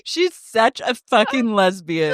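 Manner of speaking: said through laughter; laughing. Real, not acted. Laughing at Dodie.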